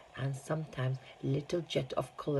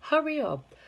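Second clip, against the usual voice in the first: urgent call; 13 semitones higher, 4 dB louder than usual. Acted. Wonka urging them on.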